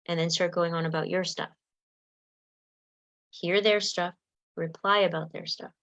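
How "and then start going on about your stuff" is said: ordinary talk, nothing marked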